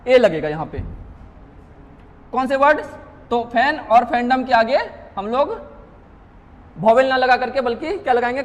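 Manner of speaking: ordinary delivery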